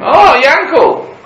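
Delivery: high, loud, drawn-out call; a friend hailing Yankel from the other side; calling across the street. Retold or imitated. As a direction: shout over the street to a friend. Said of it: "his friend's called out to him".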